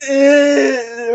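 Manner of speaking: high whiny ew; mock disgust. Optimus grossed out by parts, whiny ew. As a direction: whine out the ew. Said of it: "and he's like".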